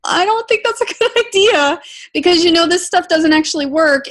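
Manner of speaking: laughing disbelief. Retold or imitated. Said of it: "And I'm like".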